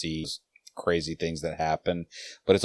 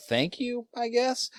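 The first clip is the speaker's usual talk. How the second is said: much higher mock-polite thank you; sarcastic thanks; 4 dB quieter than usual. Mocking people who spot film mistakes with feigned thanks. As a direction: fake gratitude to a nitpicker.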